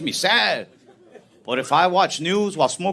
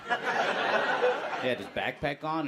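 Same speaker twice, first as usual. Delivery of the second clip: ordinary talk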